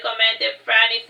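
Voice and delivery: ordinary delivery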